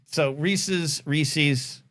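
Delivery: ordinary talk, nothing marked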